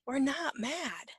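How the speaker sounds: ordinary talk, nothing marked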